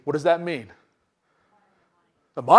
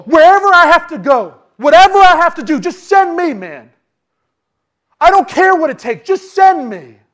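loud high pleading; eager volunteering; 9 semitones higher, 10 dB louder than usual. Acted. Isaiah begging God to send him, loud and high. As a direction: beg to be sent, full voice.

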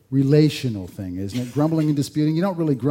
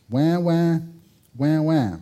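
mock crying; 3 semitones higher, slower than usual. Mocking the complainers.

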